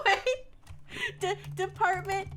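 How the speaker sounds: giggly, halting read that settles slowly; laughing while reading. Real, not acted. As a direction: start high, struggle through the laughter. Trying to read headline while laughing.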